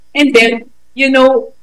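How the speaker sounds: usual speaking voice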